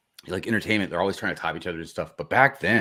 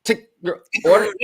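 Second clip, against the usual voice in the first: high, loud tinny intercom voice; drive-thru speaker; 10 dB louder than usual. Pretend. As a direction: crackle it like a fast food speaker box. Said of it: "the drive-thru speaker".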